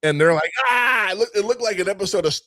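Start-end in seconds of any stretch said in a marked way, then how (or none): 0.7-1.1 high-pitched imitated cry of alarm; mock scream. Crew as the hat gets adjusted, mock scream. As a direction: yelp high like a crew thrown sideways.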